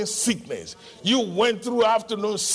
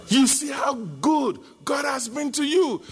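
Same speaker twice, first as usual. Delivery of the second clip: higher, louder preaching with each line hammered home; full-force preaching; 5 semitones higher, 4 dB louder than usual. Acted. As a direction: preach it like a rallying cry, building. Preaching builds, shout at God.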